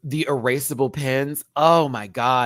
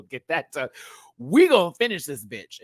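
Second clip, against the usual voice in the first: ordinary talk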